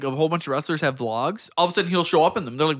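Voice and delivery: ordinary delivery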